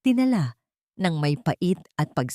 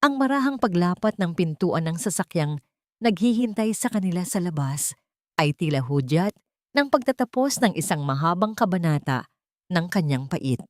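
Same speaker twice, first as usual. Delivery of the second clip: ordinary delivery, nothing marked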